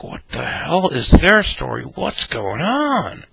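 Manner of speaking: high, loud, drawn-out disbelief; baffled onlookers. Retold or imitated. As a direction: imitate gawking diners, stretching the words. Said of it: "People are looking at us like".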